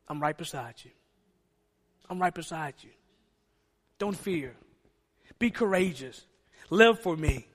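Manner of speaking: low, soft and gentle, as God comforting; whisper-close comfort to a grieving believer; tender reassurance. Acted. God's tender promise, softly voiced.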